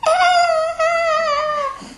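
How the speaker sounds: sudden very high scream; mock scream. Teacher screaming at an imagined snake, hammed-up shriek.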